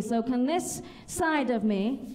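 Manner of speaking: usual speaking voice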